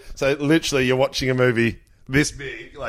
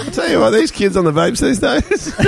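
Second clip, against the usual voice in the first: mock grumpy old-timer; 10 semitones higher than usual. Joking about the vaping man, mock grumpy old-timer. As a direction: play the fed-up old fogey.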